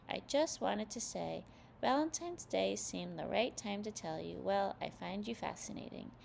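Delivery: ordinary delivery, nothing marked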